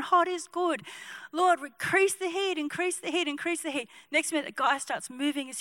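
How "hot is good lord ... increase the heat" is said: urgent prayer. Acted. Acting urgent repeated healing prayer.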